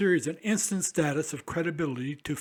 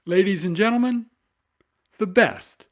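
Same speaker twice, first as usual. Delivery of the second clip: grand announcement; 3 semitones higher, 7 dB louder than usual. Acted. Host's grand show introduction.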